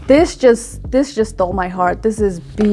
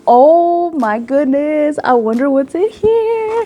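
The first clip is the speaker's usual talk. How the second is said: drawn-out wonder climbing very high; sing-song excited curiosity, rising to a squeal; excited anticipation; 5 semitones higher, slower than usual. Real, not acted. Vlogger about to open a huge box, excited.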